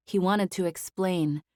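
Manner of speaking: ordinary delivery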